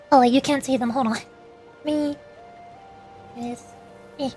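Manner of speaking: ordinary delivery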